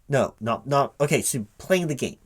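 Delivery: usual speaking voice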